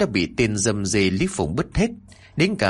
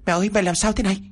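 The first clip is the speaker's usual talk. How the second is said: much higher, alarmed cry; panicked boy rushing to his mother; shocked son; 11 semitones higher, much faster than usual. Acted. Son finds mother tied up.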